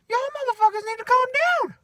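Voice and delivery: very high-pitched squeaky telling-off; mock scolding. Worker imagining stepping into the argument, mock high-pitched scolding. Acted intervention in the quarrel.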